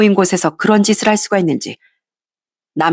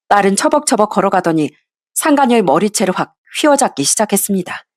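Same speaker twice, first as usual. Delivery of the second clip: usual speaking voice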